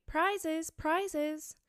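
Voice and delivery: high, eager crowd cry; calling out. A narrator's reading. Whole party crowding round Alice, calling out. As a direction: call out high, like a clamoring crowd. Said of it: "calling out in a confused way".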